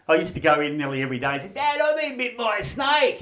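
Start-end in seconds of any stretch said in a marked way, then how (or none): 1.5-3.2 put-on high kid's call; kid calling dad. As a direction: call out to Dad as a boy raising a false alarm.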